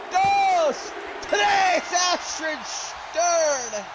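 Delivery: high, building wrestling commentary; live play-by-play. Real, not acted. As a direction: call the finish at full excitement. Calls the wrestling finish live.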